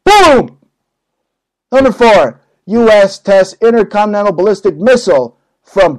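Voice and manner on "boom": high, loud mock explosion; explosion shout. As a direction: blurt it like a bomb going off. Mimicking a nuke exploding.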